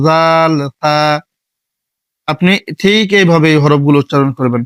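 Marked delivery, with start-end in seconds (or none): 0.1-1.0 জাল, তা set off as a recitation sample; letter demo. Shown as a demonstration. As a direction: say জাল, তা as a clean model. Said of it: "লাগাইয়া".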